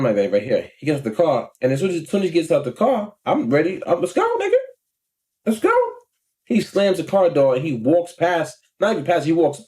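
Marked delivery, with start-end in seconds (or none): 4.0-5.7 high-pitched, eager mock challenge; acted fighting challenge. Ready as the driver gets out, acted high-pitched challenge. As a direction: replay being ready to scrap.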